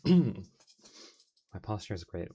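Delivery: mock proud. Streamer answering a posture check, slow and loud. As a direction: announce your perfect posture grandly. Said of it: "Posture check".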